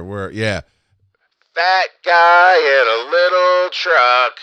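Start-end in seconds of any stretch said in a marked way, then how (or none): 1.5-4.4 booming loudspeaker announcement, slow; over a PA system. Retold or imitated. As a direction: call it out over a PA. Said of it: "let's see if I can make a P A sound here".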